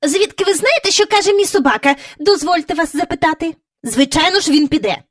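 very high, loud haughty retort; haughty lady. A narrator's reading. Miss Lark haughtily answering Mary Poppins, high. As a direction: look down on her. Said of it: "згорда подивилася на неї".

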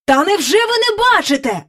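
high-pitched, excited exclamation; agitated call. A narrator's reading. King so agitated he drops his sceptre, calling out. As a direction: call out in amazement. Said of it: "гукнув король".

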